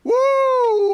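a huge, high falsetto whoop; ecstatic high woo. Real, not acted. Preacher fired up before the congregation, whooping. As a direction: let out a wild preacher's whoop.

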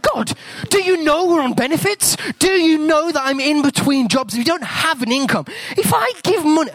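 high, panicked pleading; panicked protest. Retold or imitated. Dad protesting to God about money, high and panicky. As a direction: protest in a squeaky rush.

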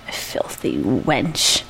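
low, slow whispered muttering; muttered. Acted. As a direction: mutter it under your breath, slowly. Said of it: "I muttered".

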